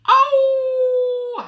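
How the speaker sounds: long, loud, very high howl of pain; wolf's howl. Acted. Wolf with door slammed on his nose, pained howl. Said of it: "howled the wolf".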